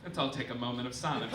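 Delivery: normal talking voice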